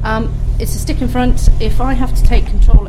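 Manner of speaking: shouting in open biplane. Real, not acted. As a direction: call it out over the engine. Pilot briefs over engine noise.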